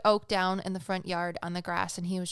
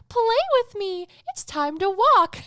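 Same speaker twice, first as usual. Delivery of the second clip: much higher, louder chirpy toy voice, mechanical and bright; talking toy voice; 14 semitones higher, 10 dB louder than usual. Retold or imitated. Toy puppy wakes in dark living room: high electronic toy voice. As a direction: play the puppy toy starting up on its own. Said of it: "And then you hear".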